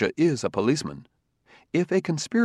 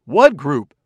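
louder, sharp, startled echo; 3 semitones higher, 9 dB louder than usual. A narrator's reading. Enderby echoing Fastolf, louder and startled. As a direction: jump in alarmed. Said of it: "echoed Commissioner Enderby almost simultaneously".

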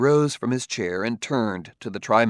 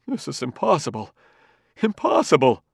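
anguished groan; 4 semitones higher than usual. A narrator's reading. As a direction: let the despair break out. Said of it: "groaned Enderby".

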